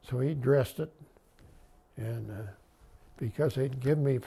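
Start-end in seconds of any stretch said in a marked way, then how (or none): none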